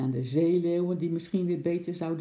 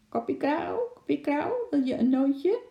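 high-pitched parrot squawk; parrot imitation; 11 semitones higher than usual. A narrator's reading. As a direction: mimic a talking parrot to tease. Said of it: "Het is een papegaaienaap".

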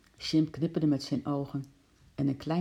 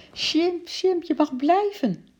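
put-on very high, loud excited child; joyful calling out; 13 semitones higher, 5 dB louder, slower than usual. A narrator's reading. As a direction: shout the good news in a high voice. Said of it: "riep Lotje en ze holde". (juichend roepend met hoge stem)

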